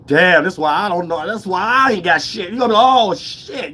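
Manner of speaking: mirror realization. Acted. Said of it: "look in the mirror and be like".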